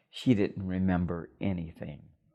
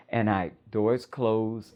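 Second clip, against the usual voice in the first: usual speaking voice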